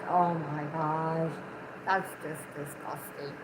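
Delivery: low, slow, soft groan of disgust; quiet drawn-out disgust; mock disgust at own voice. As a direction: sink down in mock revulsion, drawn out. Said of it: "I was like".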